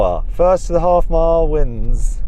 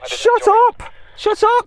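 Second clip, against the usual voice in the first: hammed-up high-pitched shout of shut up, much higher; mock shout; slower than usual. Host mock-telling Sam off for his boring comment, hammed-up high shout.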